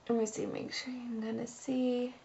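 very soft, absent-minded murmur; murmuring to self. Real, not acted. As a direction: say it quietly, half to yourself while checking. Checking camera while applying makeup.

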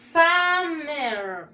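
"Summer" drawn out as SAAH-mer; English pronunciation. Shown as a demonstration. Teacher models for the class, "Summer" as a long SAAH-mer. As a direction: stretch the first vowel of "Summer" into a long ah. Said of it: "Se pronuncia en inglés".

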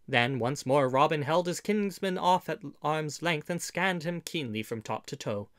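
ordinary delivery, nothing marked

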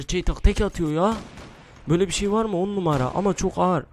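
high, loud excited gush; thrilled gamer raving to viewers; amazed. Real, not acted. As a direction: burst out in delight at the gun. Amazed by gun one-shots.

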